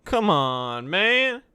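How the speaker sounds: loud, high exasperated outburst; frustrated. Real, not acted. Coder to himself over failing animations, frustrated. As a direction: cry out, really fed up. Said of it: "hear the frustration in my voice".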